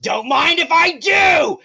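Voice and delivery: high, loud, slow hammed-up monster taunt; gleeful shark. Shark accepts the knight's dare, loud slow gloating shout. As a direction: stretch it out like a greedy brute. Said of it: "he goes like".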